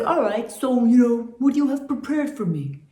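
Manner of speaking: loud, put-on producer voice; Richard Perry. Producer inviting the singers over, loud put-on man's voice. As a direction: imitate the man, big and hesitant. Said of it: "he kinda had a deep voice like this".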